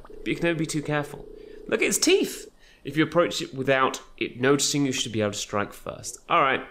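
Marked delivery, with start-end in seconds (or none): 1.7-2.5 high-pitched exclamation; amazed. Real, not acted. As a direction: squeal at a startling sight. Reacting to penguin's terrifying teeth.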